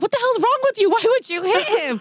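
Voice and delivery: very high, loud mock yell; shocked scolding. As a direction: imitate an appalled, angry partner. Acting partner's outburst after the slap.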